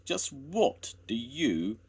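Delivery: angry. A narrator's reading. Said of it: "began Jones angrily".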